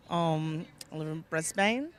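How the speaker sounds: normal talking voice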